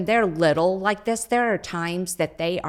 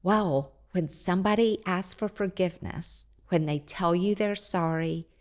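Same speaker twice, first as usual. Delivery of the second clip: patient teaching tone; 5 dB quieter than usual. Retold or imitated. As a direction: gently coach the little one, much softer. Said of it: "give them a minute or two and say".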